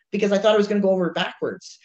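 normal talking voice

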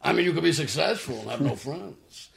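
put-on voice for a joking impression; Armand Assante. Actor quoting a castmate for laughs, mock impression. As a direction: playfully mimic a fellow actor's delivery. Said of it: "we joke around with his voice".

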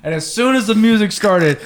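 normal talking voice

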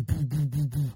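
mock thudding on glass; imitated banging. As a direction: bang out the knocks with your voice. Mimics banging on the window.

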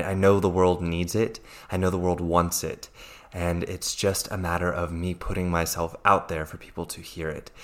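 ordinary delivery

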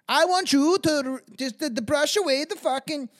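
loud, high put-on woman's voice; mock demanding woman. Woman orders her farmhand about, loud and hammed-up.

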